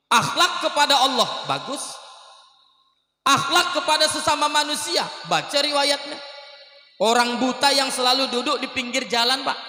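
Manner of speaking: high, loud fervent sermon delivery; impassioned preacher; preaching at full force. Real, not acted. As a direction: preach it out to the hall.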